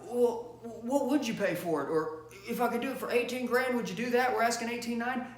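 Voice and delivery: mocking impression of a pushover salesman; weak salesman. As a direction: imitate a salesman caving on price. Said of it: "that that salesman got weak".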